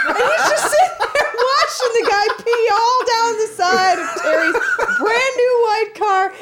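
high, loud laughing through the story; can barely get the words out for laughing; laughing hard while telling it. Real, not acted. Laughing while retelling hobo story.

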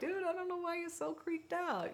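high, soft laid-back dude voice; relaxed teenager voice, high and soft; mellow dog voice. Retold or imitated. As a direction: voice the chill dog talking to his jumpy brother. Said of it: "And he's like".

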